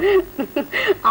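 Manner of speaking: a high, rising burst of laughter. Real, not acted. Host laughs at the rhyme.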